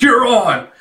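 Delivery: high, punchy mock-angry shout; pissed-off retort. Number ten accepting the challenge, acted pissed-off yell. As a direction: imitate a riled fighter taking the challenge. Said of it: "getting all pissed off".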